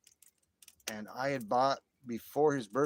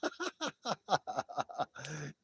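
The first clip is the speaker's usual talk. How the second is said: long, higher-pitched laughing fit; hearty laugh; 5 semitones higher than usual. Real, not acted. Laughing after whistling for viewers, hearty laugh. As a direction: burst out laughing.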